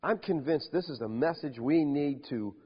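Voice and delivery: usual speaking voice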